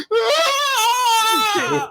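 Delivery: drawn-out high wail; imitated scream. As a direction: mimic a long cry of pain. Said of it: "you just hear him".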